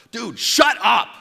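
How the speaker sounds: loud, high, drawn-out snap; mad shout. Retold or imitated. Student fed up with his chattering friend, mad shout. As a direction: lose patience and yell it slowly, hard. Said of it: "he can tell that I'm mad".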